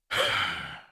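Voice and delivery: heavy exhaled sigh; imitated sigh. Clerk sighs behind the customer's back, imitated sigh. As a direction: let out an audible sigh, mimicking him. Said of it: "I hear him go".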